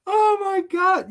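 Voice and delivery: gasping at something too funny; amused disbelief. Real, not acted. Reacts to chat message.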